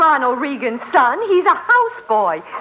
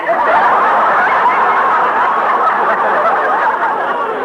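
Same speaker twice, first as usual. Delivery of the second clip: giggle. Real, not acted. [name] giggles about Reagan.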